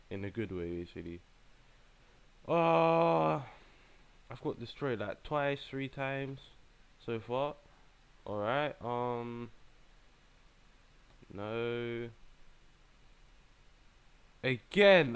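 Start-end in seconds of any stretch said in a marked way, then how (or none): 2.5-3.4 long, high, loud groan; frustrated groan. Real, not acted. Player groaning at getting destroyed, frustrated. 14.4-15.1 loud, high exclamation; exasperated. Real, not acted. Player wanting revenge, exasperated. Player facing the same opponent again.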